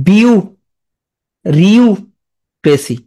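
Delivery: বিউ, long pause, রিউ, ক্রেসি; syllable by syllable. Shown as a demonstration. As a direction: sound out each chunk separately. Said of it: "তাহলে এটা পড়ে কি হবে?". (বিউ-রিউ-ক্রেসি ভেঙে উচ্চারণ)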